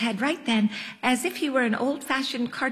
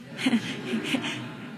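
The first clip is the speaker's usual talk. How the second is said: 1 breathy laugh; 4 dB quieter than usual. A narrator's reading. Laugh after menorah man.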